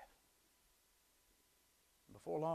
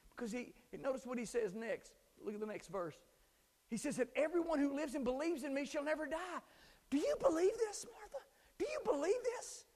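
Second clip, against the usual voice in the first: higher, louder pleading sermon voice; urgent preacher building; impassioned preaching; 9 dB louder than usual. Acted. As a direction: plead with the congregation. Preaching, still raised.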